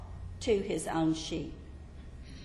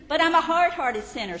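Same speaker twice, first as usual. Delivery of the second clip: pleading sinner; 8 semitones higher, 7 dB louder than usual. Acted. Sinner's objection voiced.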